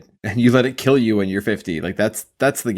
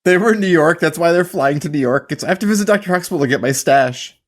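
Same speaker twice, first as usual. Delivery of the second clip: excited; 8 semitones higher, 5 dB louder than usual. Real, not acted. Excited loud joke.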